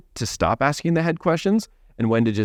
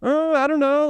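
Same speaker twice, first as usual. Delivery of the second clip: clueless client; 14 semitones higher than usual. Retold or imitated. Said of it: "What are they gonna say?".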